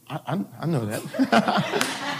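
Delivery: slow, laughing self-defence. Real, not acted. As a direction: laugh it off sheepishly. Laughing, answering wife in crowd.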